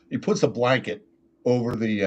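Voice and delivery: usual speaking voice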